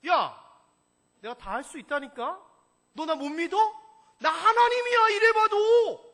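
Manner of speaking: loud, high, slowing on the last word; casual shouting God insisting he's God; pushy casual God. Shown as a demonstration. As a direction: brag like a friend who won't be doubted. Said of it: "이 이야기예요".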